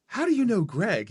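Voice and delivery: high, amazed question; surprised question. Retold or imitated. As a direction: ask it in sheer disbelief. Stunned by coincidence.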